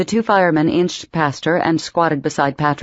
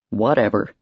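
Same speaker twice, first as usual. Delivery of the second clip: grumbling; 6 semitones lower than usual. Acted. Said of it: "he grumbled".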